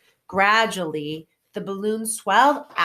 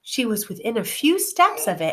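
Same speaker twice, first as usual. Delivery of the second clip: ordinary delivery, nothing marked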